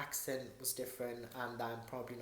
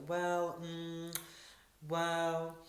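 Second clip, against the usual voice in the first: drawn-out, level "well" and hummed "mm", pitched up; imitating hesitant agent; 3 semitones higher, much slower than usual. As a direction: mimic an agent stalling between long pauses. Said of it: "that's how she kind of was talking to me".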